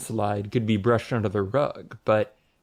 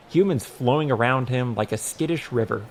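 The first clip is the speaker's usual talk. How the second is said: usual speaking voice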